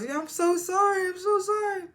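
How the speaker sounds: high, begging voice, voice pushed way up; a high frantic plea for mercy; pleading apology. Retold or imitated. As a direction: beg forgiveness in a high pleading cry. Lacey begs the elder mother.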